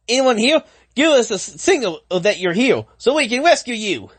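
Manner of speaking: high, urgent call for survivors. Acted. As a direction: call out high into the burning building. Character calls out in fire.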